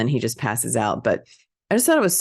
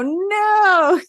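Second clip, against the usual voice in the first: high, drawn-out mock yell; mock group refusal; 4 dB louder than usual. Bikers all rejecting the captive's idea, mock yell. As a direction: imitate a whole gang shouting down a suggestion. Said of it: "they all go".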